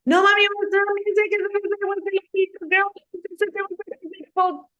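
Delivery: put-on squeaky, very high little-kid voice; little kid telling mom. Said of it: "when you are little kids telling us".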